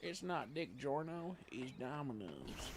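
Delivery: hammed-up slow commercial tag; mock ad slogan. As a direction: stretch it out like a jokey tagline. First take of joke slogan.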